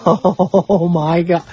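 laughing receptionist, imitated. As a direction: mimic someone breaking into laughter. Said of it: "like that. Starts laughing".

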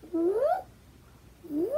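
high rising "whoop" sound effect; cartoonish slide noise, swooping upward; imitated sliding whoop. Imitates the picture sliding on wall.

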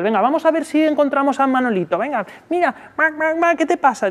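high sing-song coaxing; baby-talk to soothe a crying toddler; coaxing parent. Shown as a demonstration. Parent coaxing a fussing child, high sing-song voice.

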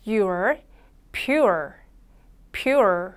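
"pure" said slowly as p plus your, the R curled back; 따라 하도록 보여주는 pure; model pure. Shown as a demonstration. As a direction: model "pure" three times for the class. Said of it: "함께 연습해 주세요".

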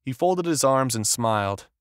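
plain reading voice

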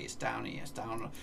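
normal talking voice

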